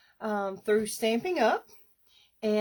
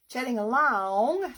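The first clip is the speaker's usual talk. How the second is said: a playful Southern US drawl on "Chatting along", pitched up a little with a rising end; 4 semitones higher, 4 dB louder than usual. Shown as a demonstration. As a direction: stretch "Chatting along" out in a light, mock Southern accent. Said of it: "That was my southern drawl".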